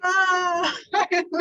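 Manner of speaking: laughing through tears, voice cracking; crying openly, can't hold it in; real crying. Real, not acted. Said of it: "I'm crying".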